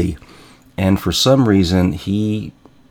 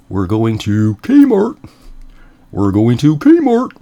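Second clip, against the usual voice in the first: drawn-out singsong chant, pitched up; brother's annoying catchphrase; 4 semitones higher, 4 dB louder, slower than usual. Retold or imitated. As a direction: needle them with a slow, loud, irritating refrain. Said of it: "had a really annoying habit".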